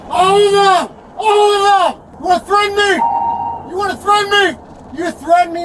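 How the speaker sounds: high, loud accusing yell; angry shouting. Real, not acted. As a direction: shout each challenge at the officer. Detainee shouting accusations at officer.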